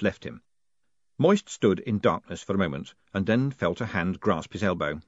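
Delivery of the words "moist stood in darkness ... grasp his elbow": ordinary delivery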